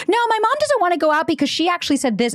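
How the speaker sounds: put-on high, insistent child voice; tattling little kid. Six-year-old exposing mom's excuse, high kid voice. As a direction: blurt it out like a six-year-old telling on mom. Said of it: "I used to do that to my mom".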